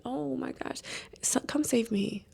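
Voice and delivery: soft whispered plea; whispering. Real, not acted. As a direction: whisper it like a secret cry for help.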